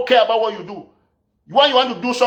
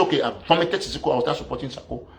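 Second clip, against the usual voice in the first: mock concession; 6 semitones lower, 6 dB quieter than usual. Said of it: "Then I'll, I'll say".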